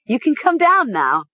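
high, giddy call; giddy sputter. A narrator's reading. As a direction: call up to him as if in on a prank. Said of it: "she sputtered".

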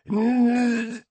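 high, drawn-out straining noise; straining grunt. A narrator's reading. Master straining on the machine, high grunt. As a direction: strain out a high wordless sound. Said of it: "said the master".

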